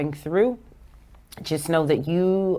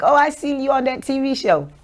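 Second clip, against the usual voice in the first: excited fan; 9 semitones higher, 5 dB louder than usual. Retold or imitated. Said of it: "People are like".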